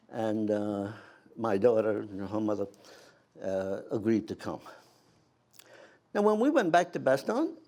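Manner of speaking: ordinary talk, nothing marked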